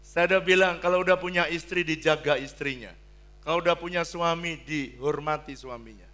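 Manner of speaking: loud exhortation; preaching at full force. Acted. Preacher instructing husbands and wives, loud and firm. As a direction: command it from the pulpit.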